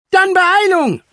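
high, loud hurry-up cry; urgent call. A narrator's reading. Franka rushes the rescue party, calling out urgently. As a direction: call out sharply, pitch way up. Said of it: "rief Franka".

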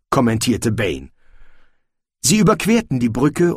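ordinary delivery

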